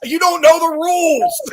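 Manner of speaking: high, loud, slow scolding of the crowd; loud scolding shout. Retold or imitated. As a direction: yell it at fans who miss the rules. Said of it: "I'm like".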